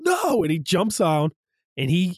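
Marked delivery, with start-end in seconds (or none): 0.0-0.4 anguished cry. Retold or imitated. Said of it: "you just see Andrew Garfield".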